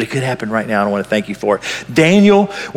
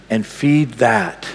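usual speaking voice

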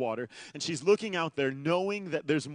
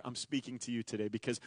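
hushed appeal; 5 semitones lower, 9 dB quieter than usual. Acted.

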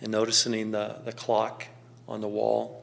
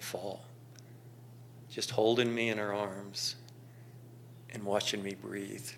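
hushed reflection; 5 dB quieter than usual. Acted. Son remembering his mother, in a hushed reflective voice. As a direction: let it sink in gently, almost to yourself.

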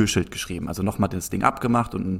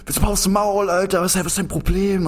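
imitated outburst; 9 semitones higher than usual. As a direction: play the guy snapping at him. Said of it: "komplett ausgerastet".

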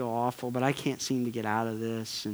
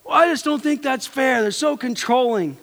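much higher, louder mock indignant whine; frustrated complaint; 10 semitones higher, 6 dB louder than usual. Preacher mocking a spouse refusing phone access, indignant whine. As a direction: whine that it isn't fair, hammed-up. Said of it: "And they're all like".

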